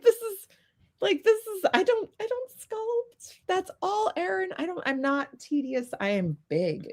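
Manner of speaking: high-pitched retold fretting; mock panic. Telling a teammate she can't sculpt, mock panicked. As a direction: fret in a high voice, settling as it goes.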